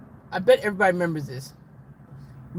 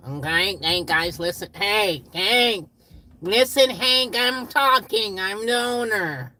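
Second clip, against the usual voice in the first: loud mock boss demanding attention; imitated boss; 5 semitones higher, 6 dB louder, slower than usual. Boss telling the gang to listen, mock shouting. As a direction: raise your voice higher as a bossy owner. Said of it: "that's what the boss came in today".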